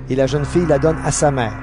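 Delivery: ordinary delivery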